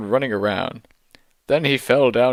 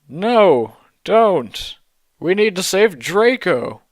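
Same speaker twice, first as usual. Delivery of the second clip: higher pleading voice; begging; 6 semitones higher, 3 dB louder than usual. A narrator's reading. Teens begging Dumbledore to save Draco, higher pleading. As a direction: plead with him, a touch desperate. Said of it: "we begged".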